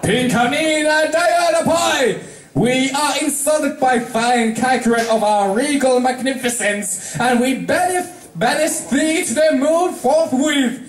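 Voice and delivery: an offended monarch pronouncing sentence; regal angry princess. Acted. Celestia banishes Pinkie.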